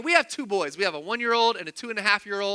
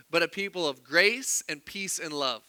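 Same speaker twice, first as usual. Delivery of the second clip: normal talking voice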